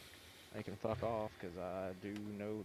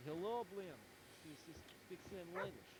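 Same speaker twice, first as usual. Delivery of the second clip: higher, playful mock Russian accent; 7 semitones higher, 5 dB quieter than usual. Friend replying in a joking Russian accent. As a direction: answer back in a teasing Russian accent. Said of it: "my extent to Russian is".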